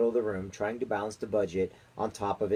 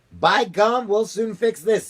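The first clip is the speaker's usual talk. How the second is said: high loud determined snap; snapping; 11 semitones higher, 7 dB louder, slower than usual. A narrator's reading. President vows to fix the threat, loud and high. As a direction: bark it out decisively. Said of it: "snapped the president".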